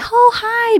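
very high, chirpy hi; squeaky sing-song hello; bubbly greeting. Pretend. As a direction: chirp it like the cheerful girl everyone expects. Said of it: "being like".

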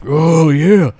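high, cocky gang bro. Acted. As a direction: hype them up like smug bros. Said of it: "Little bros?".

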